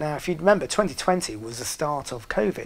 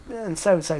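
ordinary delivery

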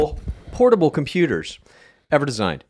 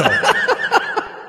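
laugh. Real, not acted. Laughing at joke.

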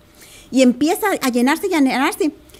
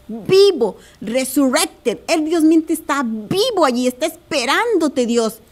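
normal talking voice

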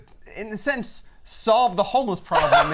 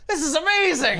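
mock thrilled Lizard; 8 semitones higher than usual. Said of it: "he's like".

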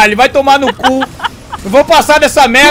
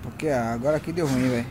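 cursing under breath; 13 semitones lower, 17 dB quieter than usual. Real, not acted. Muttered to himself.

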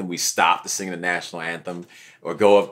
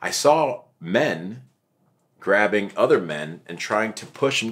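ordinary talk

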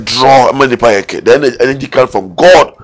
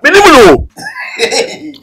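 very high, drawn-out laughing cry; squealed protest, half laughing; much slower than usual. Real, not acted. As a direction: wail the denial slowly through laughter. Heated denial, breaking into laughter.

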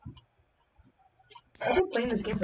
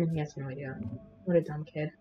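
lower, softer sneaky aside; sneaky hush; 5 semitones lower, 6 dB quieter, faster than usual. Real, not acted. Gamer stalking an unaware kid, sneaky hushed aside. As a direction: hush it like you're stalking someone.

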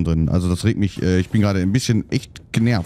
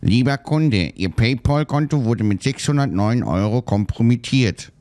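robot voice imitation. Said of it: "diese weibliche Roboterstimme".

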